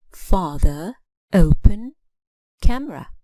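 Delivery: "Father, open, camera" each stressed on the first syllable. Shown as a demonstration. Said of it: "hear the stress on the first syllable".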